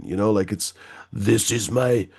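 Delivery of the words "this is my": put-on German accent. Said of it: "that's a fucking weird accent".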